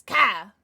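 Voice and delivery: Ka punched loud, with no L; Cartman's Kyle. Retold or imitated. Streamer naming the child in Cartman's way, loud Ka. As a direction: say Kyle as a loud one-syllable Ka. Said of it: "The child's name is Ka".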